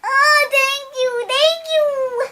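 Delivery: overjoyed scarecrow thanking the wizard; squeaky delighted thanks; grateful scarecrow. Retold or imitated. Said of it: "he was like".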